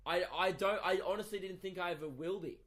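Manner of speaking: ordinary talk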